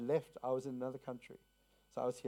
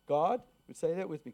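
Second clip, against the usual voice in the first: ordinary talk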